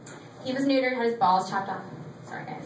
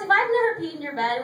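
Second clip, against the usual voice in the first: normal talking voice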